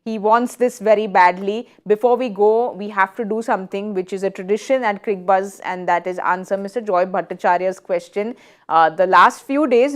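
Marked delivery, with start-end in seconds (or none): none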